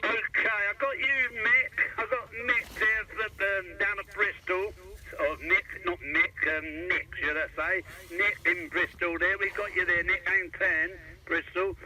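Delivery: ordinary talk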